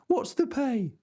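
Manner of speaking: peasant voice. Acted. Sancho asks pay.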